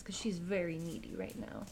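normal talking voice

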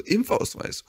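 mother hearing about the vaccination card, a high startled echo; mum's surprised question. Retold or imitated. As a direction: echo the word as if it's absurd. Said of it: "Meine Mutter erstmal so".

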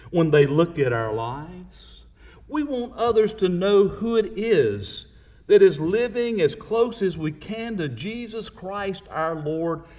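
usual speaking voice

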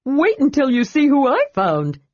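high, eager voice; excited cry. A narrator's reading. Tass to his friends about his find, crying excitedly. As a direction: burst out high with the news. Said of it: "Tass cried".

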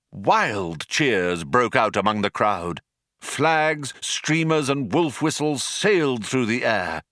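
ordinary delivery, nothing marked

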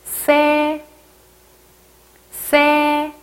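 세 with a ㅆ onset, high level pitch; tense s sound. Shown as a demonstration. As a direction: model 세 like "sse", vowel held level. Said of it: "쌍시옷으로 되어 있죠".

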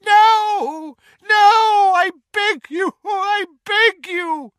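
very high, loud, desperate begging; screaming in agony. A narrator's reading. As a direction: beg for your life, screaming. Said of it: "a scream of unendurable agony".

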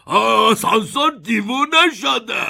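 high, loud, slow exclamation; alarmed cry. A narrator's reading. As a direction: cry out in alarm at the runaway ride. Grandpa alarmed in runaway elevator.

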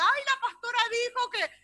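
high, loud mocking quote of a churchgoer; hammed-up gossiping member. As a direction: parody the one who repeats gossip. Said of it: "No diga".

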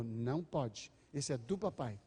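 put-on low, quiet warning to a baby; soft, low-pitched parental rule; firm father's no. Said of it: "Papai falou".

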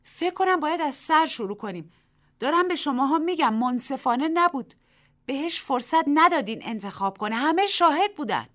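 loud, high pleading protest. Acted. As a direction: object out loud to the whole crowd. Tessie protests the lottery.

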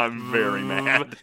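slow, put-on growly Hulk; a hint of a lumbering Hulk; mock Hulk voice. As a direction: drag it out like the Hulk. Joking they are the Hulk.

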